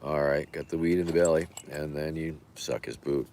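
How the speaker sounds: ordinary delivery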